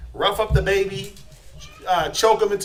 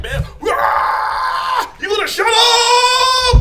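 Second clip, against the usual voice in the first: much higher, louder, slow yell; furious shout; 8 dB louder, much slower than usual. Acted. Impatient parent yelling at crying baby, furious shout.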